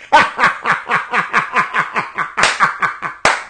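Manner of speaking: breathy disbelieving laughter, fading; long laugh. Real, not acted. Laughing at the commentary's line, real disbelieving laughter.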